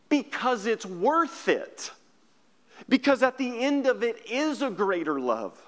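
fervent preacher lifting the room; preaching at full force. Acted. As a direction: build the sermon to a passionate peak. Preaching climax, raised voice.

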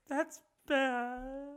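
high, soft, stretched-out weepy wail; hammed-up tearful whimper; mock crying. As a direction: imitate him crying over it. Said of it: "Crying, dude".

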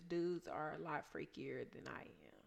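unvoiced secret aside; whisper. Real, not acted. To her cohost, a confiding whisper. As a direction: let it slip as a whisper.

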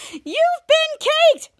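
high, loud, drawn-out triumphant cry; gloating. Retold or imitated. Piper announcing the cake prank to Henry, gloating. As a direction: crow it slowly in a high squeal.

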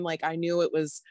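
ordinary delivery

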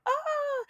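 high pitched mock scream of pain; a short high cry of pain from the contraction; retold scream. Screaming at the door as a contraction hits, high cry. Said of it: "screaming out".

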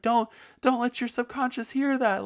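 very high, loud panicked shout; hammed-up alarm; mock panicked shout. Podcast guest shouting at the host, hammed-up panic. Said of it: "He's like".

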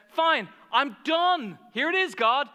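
high, loud acted outburst; humiliated and fed up. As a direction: imitate someone hurt and quitting in a huff. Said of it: "she felt humiliated, and she says".

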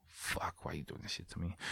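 barely audible mock anguish; hushed protest. Retold inner protest to wife.

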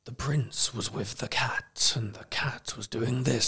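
hushed, slow, creepy whisper; sinister whisper. Retold or imitated. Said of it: "this sinister voice reads it".

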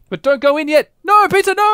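high, loud panicked no; alarmed shout. Real, not acted. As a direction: yell to stop them going in. Warning friend not to enter.

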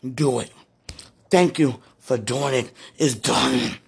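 ordinary delivery

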